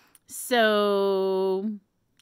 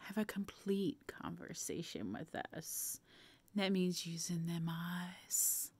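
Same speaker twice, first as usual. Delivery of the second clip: sexy whisper; 4 semitones lower, 17 dB quieter than usual. Shown as a demonstration. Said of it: "in an erotic voice, sexy voice".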